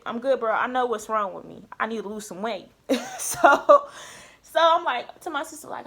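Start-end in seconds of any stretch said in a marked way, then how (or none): 3.2-3.9 high loud laugh. Real, not acted. Laughing at herself.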